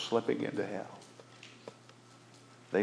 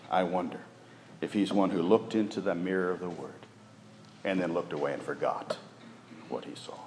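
usual speaking voice

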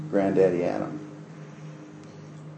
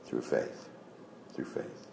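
whispered, much softer; hushed repetition; 9 semitones lower, 17 dB quieter, slower than usual. Real, not acted. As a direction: breathe it out like a secret.

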